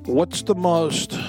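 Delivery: ordinary talk, nothing marked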